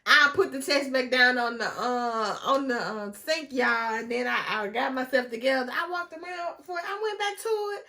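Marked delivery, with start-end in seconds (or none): none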